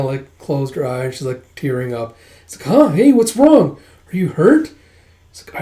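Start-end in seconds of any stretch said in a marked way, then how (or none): 2.7-4.7 high, loud worried questions; acted startled concern; alarmed concern. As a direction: jump in, alarmed for a friend. Acted friend finds her tearing up.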